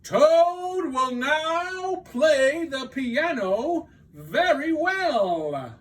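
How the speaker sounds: high, slow, shouted showman announcement; booming announcer. Acted. Strange voice announcing the dream star, booming and slow. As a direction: call it out like a circus ringmaster. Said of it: "said the strange voice".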